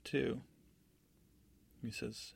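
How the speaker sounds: ordinary delivery, nothing marked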